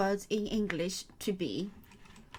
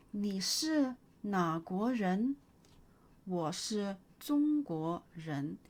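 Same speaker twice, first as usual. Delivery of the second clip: slow model dialogue; slower than usual. Shown as a demonstration. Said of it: "teacher models question and answer".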